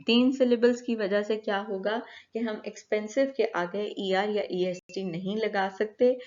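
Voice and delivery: usual speaking voice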